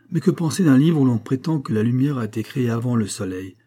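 plain reading voice

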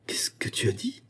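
a stunned, breathless whisper rising on dit; stammered whisper. A narrator's reading. Stunned husband whispering back at his accusing wife. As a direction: barely get the words out, shaken. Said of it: "balbutia Georges".

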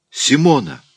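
high, loud cry of a name; shouting. A narrator's reading. Raoul hears the medium scream and calls her, alarmed shout. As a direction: call out in alarm. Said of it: "закричал Рауль".

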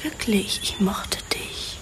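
soft whisper, intimate and regretful; a sad whispered goodbye; whispered confession. Acted. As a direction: breathe it to him softly. Repeating her confession.

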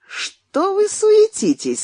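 high, slow playful teasing; cheerfully mock their fuss; весело. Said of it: "сказал Изя весело". (весело, с насмешкой)

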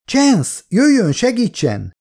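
high, urgent cry for help; calling out. A narrator's reading. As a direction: call desperately to a companion. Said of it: "kiáltott közben Elizabeth a társa felé".

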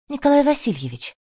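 high, surprised recognition; surprised and sympathetic. A narrator's reading. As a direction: call out his name in sympathetic surprise. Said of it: "с удивлением и сочувствием".

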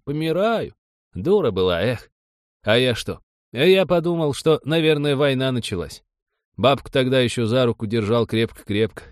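put-on lively elderly rustic storyteller; old villager. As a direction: tell it like a village grandfather. Voices elderly villager recalling the event.

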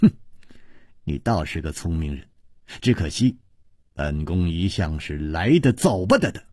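low, quiet icy contempt; cold sneer. A narrator's reading. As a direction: threaten softly with a sneer. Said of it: "龚南燕冷笑着说". (低声冷笑，冷冰冰地威胁)

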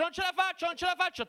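very high strained shouting; straining shout. Retold or imitated. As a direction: yell while pulling hard. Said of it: "Io sto lì che tiro e faccio".

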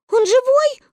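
put-on very high, loud anxious children's shout; children's tearful cry. A narrator's reading. Children shouting from above, tearful alarm. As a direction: cry out like frightened little kids. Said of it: "кричали мы сверху и обливались горючими слезами".

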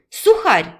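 high, loud one-word jab; indignant insult. A narrator's reading. Raina insults the unfeeling Serge, indignant.

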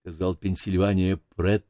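usual speaking voice

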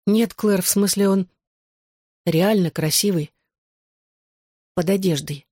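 ordinary delivery, nothing marked

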